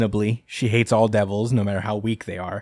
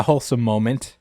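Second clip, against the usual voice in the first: ordinary talk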